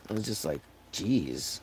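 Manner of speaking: ordinary talk, nothing marked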